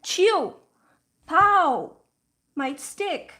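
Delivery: put-on high, loud toddler insisting; imitating an exasperated little boy correcting his uncle; frustrated toddler. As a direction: stamp out each syllable like a cross two-year-old. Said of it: "Lauren gets very frustrated".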